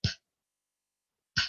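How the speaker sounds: ordinary delivery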